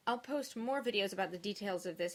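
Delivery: normal talking voice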